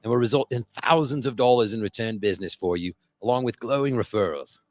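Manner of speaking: put-on Aussie or Irish accent. Host reading the sponsor ad to listeners in a mock Aussie or Irish accent. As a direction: sell it in a half-Aussie, half-Irish lilt. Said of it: "today it's a different accent".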